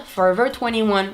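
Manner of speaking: "Forever twenty-one" with English-sounding r; English accent. Shown as a demonstration. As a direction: switch into a native-like English accent. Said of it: "généralement, la plupart du temps, vous allez m'entendre dire".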